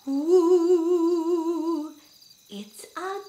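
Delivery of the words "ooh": spooky rising ooh; ghost noise. Pretend. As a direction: moan it like a friendly ghost. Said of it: "make a ghost noise like me".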